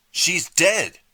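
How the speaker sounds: very high, loud accusing yell; furious shout. A narrator's reading. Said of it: "Artie shouted".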